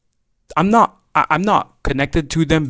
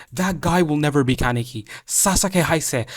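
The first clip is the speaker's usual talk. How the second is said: usual speaking voice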